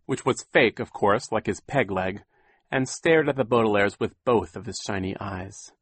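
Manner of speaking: plain reading voice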